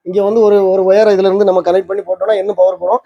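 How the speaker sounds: normal talking voice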